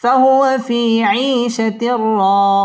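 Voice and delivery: slow melodic tajweed chant, drawn out; Quran recitation. Shown as a demonstration. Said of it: "wow ঠোঁট গোল করে উচ্চারণ করতে হবে". (ধীর সুরেলা তিলাওয়াত)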